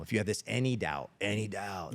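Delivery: a hint of courtroom pleading; mock defense attorney. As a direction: lightly mimic a lawyer pressing the jury. Acting the defense attorney's jury argument.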